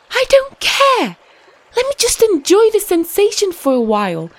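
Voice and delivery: high, giddy small fish voice; carefree and thrilled; excited little fish. Acted.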